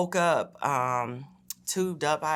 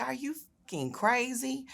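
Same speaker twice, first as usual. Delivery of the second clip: high, drawn-out disbelieving snap; retold outrage at an absurd request; incredulous outrage; 10 semitones higher, 3 dB quieter than usual. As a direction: throw it back at the nurse like she's lost her mind. Refusing to push baby out.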